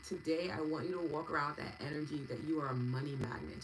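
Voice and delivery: ordinary delivery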